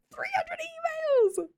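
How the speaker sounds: very high, slow wail on "three hundred emails!"; mock dismay. Real, not acted. Back at work facing emails, high horrified wail. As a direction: drag it out high in horror.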